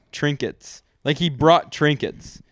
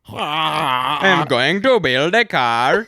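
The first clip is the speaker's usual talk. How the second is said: slow, louder, higher mock German accent; 4 semitones higher, 5 dB louder, much slower than usual. Shown as a demonstration. Showing his friend a German accent is fine, slow and loud. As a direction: spell it out slowly in a hammed-up German accent. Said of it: "really clean German accent".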